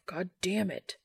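gruff frustrated curse. Acted. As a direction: grumble it gruffly at the furniture. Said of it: "with a gruff".

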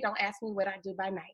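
ordinary talk, nothing marked

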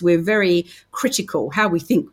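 normal talking voice

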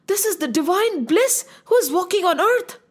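gasp, then high-pitched wonder; awed realisation. Acted. Sage sees the divine in Ram's heart, gasping amazement. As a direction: gasp and marvel at the revelation.

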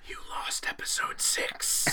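slow, drawn-out whisper; menacing mock deathbed whisper. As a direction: lean in and whisper it slowly. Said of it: "I will just lean in and whisper".

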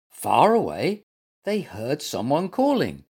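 plain reading voice

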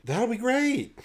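high-pitched, gushing condescension; hammed-up patronizing squeal. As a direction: humor them in an exaggerated high voice. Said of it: "replies with a giggle, patronizingly".

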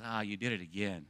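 low, slow, soft taunting of the enemy; a hushed, hint-of-sinister accuser; quiet accusing voice. Retold or imitated. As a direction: lean in and sneer it quietly. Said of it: "And he says".

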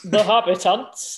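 light imitation of Northern Yorkshire; Northern Yorkshire accent. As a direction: echo it with a touch of Yorkshire. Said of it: "in a Northern Yorkshire".

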